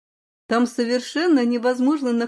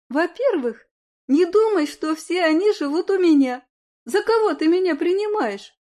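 pouty; 7 semitones higher, 3 dB louder than usual. Acted. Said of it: "Надула губки Люда".

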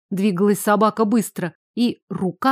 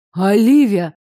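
insistent call; 4 dB louder, slower than usual. A narrator's reading. Richard calls Olivia insistently.

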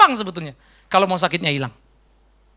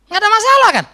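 mocking loud question; 13 semitones higher, 8 dB louder than usual. Preacher mocks easy complaint.

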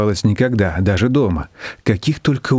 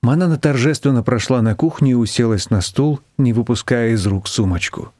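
plain reading voice

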